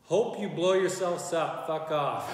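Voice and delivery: loud, slow mock hater read; mock angry read. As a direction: bark it like an internet troll. Reading a hateful comment aloud.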